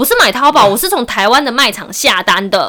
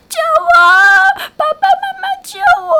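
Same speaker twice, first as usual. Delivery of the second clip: put-on much higher, fast child pleading; screaming kid. Scam caller playing the kidnapped son, faked child pleading. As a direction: shriek like a kidnapped child begging. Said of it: "另外一头就是说".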